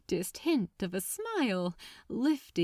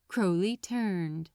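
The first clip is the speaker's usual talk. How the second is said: usual speaking voice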